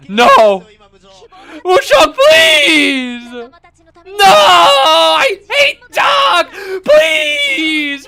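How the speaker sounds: high, loud crying shout; anguished screaming. Real, not acted. Streamer screaming in despair as Doc survives, crying out loud.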